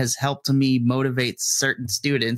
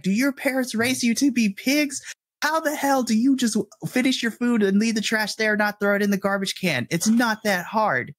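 much higher, disgusted scolding of the class; angry inner rant; 9 semitones higher than usual. Retold or imitated. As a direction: rant at messy kids, pitched way up. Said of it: "this is the internal translation".

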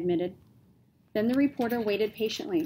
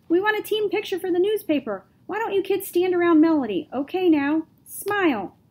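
loud, high reporter's call; 10 semitones higher, 8 dB louder than usual. A narrator's reading. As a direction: call out over the crowd. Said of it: "another reporter asked loudly".

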